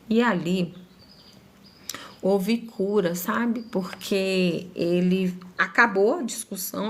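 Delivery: normal talking voice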